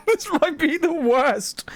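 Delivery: high, slow, through laughter; giggly dread. Real, not acted. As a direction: draw it out while giggling at your own bad plan. Giggling over a risky choice.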